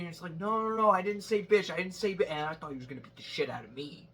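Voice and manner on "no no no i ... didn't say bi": higher, louder frantic backpedal; imitating panicked denial. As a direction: beg him it was nothing.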